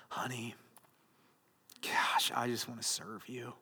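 breathy, sugary whispered sweet talk, put on; mock devoted whisper. Husband fawning over his wife, mock devoted whisper.

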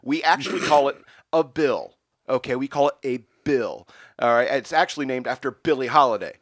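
loud, high mock rant; railing rant. Still ranting at Ben Franklin about the hundred dollar bill. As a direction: lecture him, indignant. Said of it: "continued railing at him".

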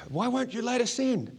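high-pitched mock yell from a rowdy gang; imitated yell. Rowdy teen outside shouting to get into the party, mock yell. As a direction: call it out high, no real volume. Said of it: "someone yelled out from that group".

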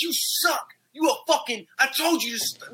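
high, spluttering retold rant; cussing out. Imitating the dealer cursing crying informants, angry. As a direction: mimic someone cursing in high-pitched outrage. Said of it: "watch him cuss out six people".

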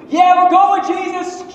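loud, high cheer; hyped disciple yelling yes; eager disciple. Retold or imitated. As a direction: shout it like an eager follower.

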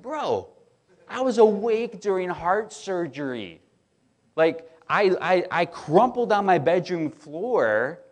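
loud, high, incredulous outburst; emphatic retort. Retold or imitated. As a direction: make the case with rising exasperation. Acted emphatic retort to questioner.

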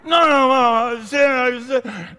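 high, loud, hammed-up child's wail; pleading kid. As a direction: play the sobbing boy begging his mom. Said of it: "through my tears and through my snot".

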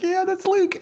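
very high, certain and thrilled; the fan knows for sure it's Luke; full fan squeal. Retold or imitated. Fan seeing the green lightsaber, very high delighted squeal. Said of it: "and you're like".

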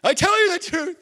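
high, loud emotional outburst; exclaimed outburst. Acted. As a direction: blurt it out, unable to hold it in. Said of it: "blurts it out".

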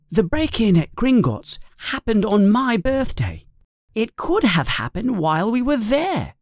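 put-on high, eager boy realising something; sudden boyish realisation; Harry connecting the robbery to his birthday; Harry's voice. A narrator's reading.

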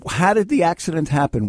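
normal talking voice

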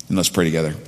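usual speaking voice

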